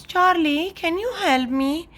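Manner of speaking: high, plaintive little-sister call; calling for help. A narrator's reading. Little sister calls brother at the slide, pleading. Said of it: "Lola calls".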